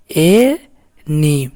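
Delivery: एनी drawn out very slowly; slow sounded-out word. Shown as a demonstration. Teacher blending एनी for students, slowly stretched. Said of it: "इस तरह से एनी पढ़ाएगा".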